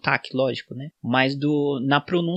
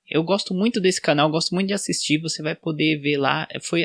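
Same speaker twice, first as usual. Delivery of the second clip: normal talking voice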